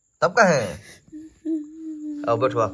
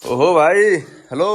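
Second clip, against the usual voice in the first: raised, louder call to get attention; 4 semitones higher, 7 dB louder, slower than usual. Acted. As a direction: call out loudly, pitch lifted. Calling out to someone unresponsive.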